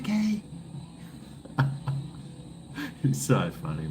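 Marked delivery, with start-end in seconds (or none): 0.0-0.4 put-on high meek kid reply; kid neighbour. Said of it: "He's like". 1.4-3.0 airy high giggle; breathy high laugh. Real, not acted. Laughing at kid's reply.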